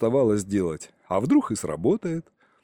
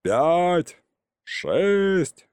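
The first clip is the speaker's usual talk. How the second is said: higher-pitched, stretched-out count; yelled count; 6 semitones higher than usual. A narrator's reading. Uncle resuming his countdown for the enemy, yelled. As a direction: call each number out high and long. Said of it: "И снова заорал".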